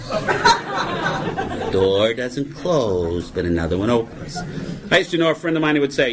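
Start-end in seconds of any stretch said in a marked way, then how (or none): 0.1-4.4 very slow, low, drawn-out with a laugh; mock-solemn. Shown as a demonstration. Comic parodying AA cliché, slow mocking drawl.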